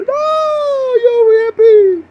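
very high, loud mock wail; mock scream. Podcaster jeering at his hippie friend, mock scream. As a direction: scream it in falsetto, drawn out.

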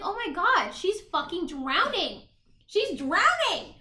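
high, loud, drawn-out disbelief; hammed-up astonished exclamation; incredulous outcry. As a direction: replay your shock, stretching the key word slowly. Replaying reaction to drowning scene.

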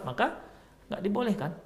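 ordinary talk

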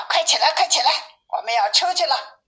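high-pitched mother rousing her cubs; waking call. A narrator's reading. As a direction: urge sleepers out of bed. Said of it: "妈妈就把他们都喊了起来".

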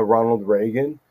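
ordinary delivery

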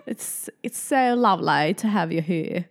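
lightly put-on British English accent. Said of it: "richtig guten britischen Akzent".

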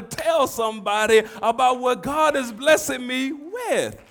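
loud, high-pitched preacher delivery; impassioned pulpit proclamation; full-force preaching. Real, not acted.

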